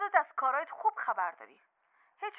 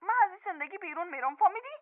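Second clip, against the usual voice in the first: ordinary delivery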